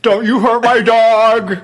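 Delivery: high, loud, drawn-out mock shriek; movie-quote scream. Co-host quotes Silence of the Lambs, hammed-up scream. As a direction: yell it hammed-up, stretching the words through laughter. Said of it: "it's Silence of the Lambs".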